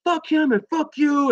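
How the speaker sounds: high-pitched mock yell, stretched at the end; imitated yelling. Retelling a commotion at the front of the bar, mock yelling. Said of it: "somebody was yelling".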